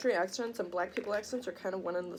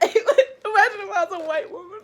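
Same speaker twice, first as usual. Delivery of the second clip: high, loud, through laughter; laughing shriek; 12 semitones higher, 12 dB louder than usual. Real, not acted. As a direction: shout it high while cracking up. Cracking up at own joke.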